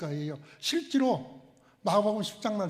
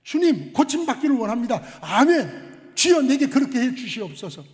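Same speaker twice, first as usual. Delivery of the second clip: eager plea; 5 semitones higher, 9 dB louder than usual. Shown as a demonstration. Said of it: "뭐라고 대답해야 될까요?".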